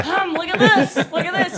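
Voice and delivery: high, loud, laughing plea; mock attention-seeking. Jealous rider wanting her hair petted, loud mock plea. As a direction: playfully demand to be looked at.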